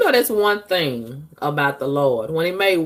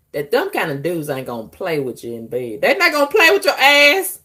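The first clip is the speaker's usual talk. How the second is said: ordinary delivery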